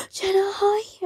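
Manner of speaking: gasp, then high squealed hello; retold gushing high-pitched hello; excited greeting.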